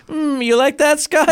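high, gloating mock taunt; taunting cartoon kid. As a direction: gloat over him in a high voice. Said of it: "It was like".

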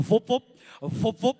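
slow, loud mock gate whooshing; boom gate whoop. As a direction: play the gate swinging open and shut. Imitating boom gate swinging.

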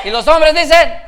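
very loud, high rallying shout; shouted call. Real, not acted. Preacher setting women against men for amens, shouted call. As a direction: shout to whip up a response.

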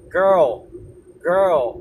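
put-on "Girl" as one glide, g-r-l; smooth English girl. Shown as a demonstration. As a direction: model "Girl" clearly, ending tongue behind front teeth. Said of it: "そのままLで前歯の後ろにつく". (GからR、Lへ滑らかに「Girl」)

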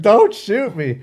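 high, loud hammed-up plea; mock pleading. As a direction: squeal it like a joke victim.

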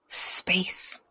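just breathe the word space; a soft unvoiced space; whispered. Real, not acted.

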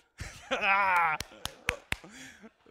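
big burst of real laughter; a loud, high laugh at his wife's joke. Real, not acted.

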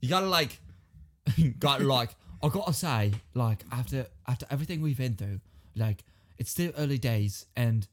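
Liverpool-sounding English patter; English accent. Shown as a demonstration. Stalling a romance speech, Liverpool-sounding English accent. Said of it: "you sound like you're from Liverpool".

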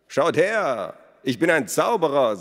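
loud, high grand announcement; showman. A narrator's reading. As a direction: present it like a stage magician. Elos shows off the gold. (Elos verkündet laut und hoch wie ein Bühnenzauberer)

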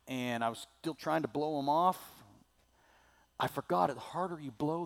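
usual speaking voice

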